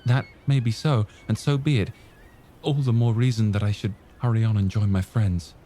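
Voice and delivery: the lad pressing to get back to his friends; youthful, keen voice; young Jim. Acted.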